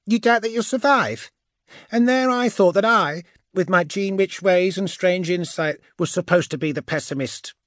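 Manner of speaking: very high, loud, teasing witch; high-voiced, wry taunt; Andromeda, mock surprise. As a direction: needle him in a high, incredulous voice. Andromeda needles Archamus.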